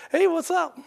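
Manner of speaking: high-pitched mock-casual hello; playful casual greeting. Jesus popping up among the startled disciples, playful casual greeting. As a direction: toss out a jokey, high hello.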